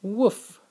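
one high, loud yappy woof; playful puppy woof; dog bark. Acted. Dad barks for the dog.

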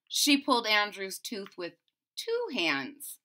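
ordinary delivery

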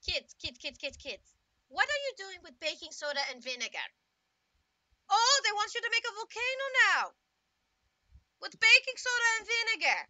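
high, loud exasperated scolding; exasperated TF2 dad. Pretend. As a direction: groan at the kids' sorry volcano. Dad voice, baking soda volcano.

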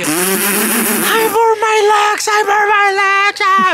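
grito agudo y muy lento de dolor; gritando con dolor. Retold or imitated. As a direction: alarga cada palabra como un grito desesperado. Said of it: "Estaba gritando, llorando". (grito de dolor al teléfono)

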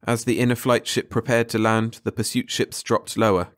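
plain reading voice

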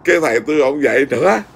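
normal talking voice

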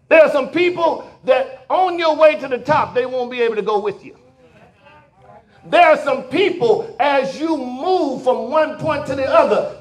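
preacher rallying the church to let go of folk; full-force preaching. Acted. Preacher exhorts congregation at full force.

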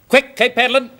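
high, loud urgent command; urgent shout. Acted. Doctor to man powering the light, urgent acted shout. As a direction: call out hurriedly to someone outside.

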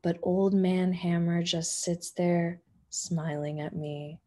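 plain reading voice

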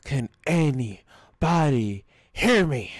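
loud. Real, not acted. Ignored on game mic.

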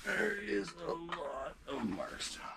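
slow, breathy whisper. Real, not acted. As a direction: whisper so the fish can't hear. Hushed while fish below.

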